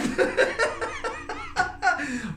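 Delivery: very high squealing laugh; shrill giggle. Real, not acted. Giddy laugh after greeting.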